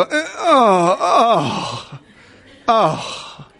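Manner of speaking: high, sore groans of pain; hammed-up sore moans; mock groaning. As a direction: groan like every muscle aches. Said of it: "sit down and go".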